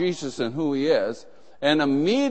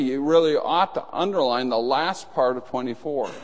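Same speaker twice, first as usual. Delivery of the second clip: ordinary talk, nothing marked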